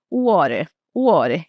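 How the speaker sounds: Canadian way; Canadian flapped water. Shown as a demonstration. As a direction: tap the T lightly in "water". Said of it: "ele vai fazer, né, usar esse flap aí".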